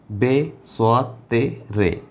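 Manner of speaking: slow, separated letter names; slow spelling. Shown as a demonstration. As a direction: dictate each letter slowly. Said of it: "letters spelt out slowly". (अक्षरों के नाम धीरे और अलग बोलना)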